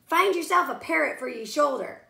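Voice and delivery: loud command. Acted. Captain giving orders.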